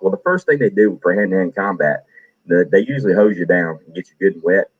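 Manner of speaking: ordinary talk, nothing marked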